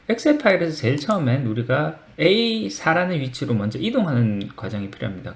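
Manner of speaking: ordinary delivery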